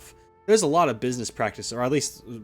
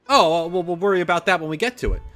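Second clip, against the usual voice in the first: loud, higher, rushed mock dismissal; hurried, louder put-on boss reply; imitated brush-off; 5 semitones higher, 7 dB louder, faster than usual. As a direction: wave the worry away loudly and fast.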